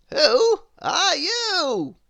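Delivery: loud, high, slow question; cheerful old wizard; jolly wizard. Acted. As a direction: ask it grandly and slow. Wizard voiced in story.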